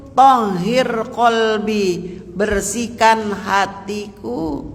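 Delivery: very slow, drawn-out prayer line; prayer recitation. Shown as a demonstration. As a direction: recite it slowly, stretching each word. (doa dilafalkan pelan-pelan)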